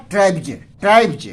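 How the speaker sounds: ट्राइब्स ending in a voiced "j"; final j sound. Shown as a demonstration. Teacher shows students how ट्राइब्स is read, final s turned to j. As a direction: say ट्राइब्स as "traib-j", not with an s. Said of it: "लेकिन हम पढ़ेंगे क्या".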